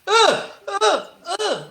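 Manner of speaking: repeated high-pitched "uh" sounds; imitated wordless grunts. Woman as her pencil gets grabbed, imitated high grunts. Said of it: "and she just went".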